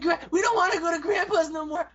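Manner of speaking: put-on high, loud whining child voice; mock kiddie whine; little grandkids. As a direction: protest like a scared little kid. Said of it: "my grandkids when they're little".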